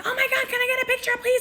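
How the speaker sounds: very high, racing squeal; mock a gushing fangirl at double speed; obnoxious fan begging. Girl begging security for a picture, obnoxious squeal. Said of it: "Being really loud and obnoxious".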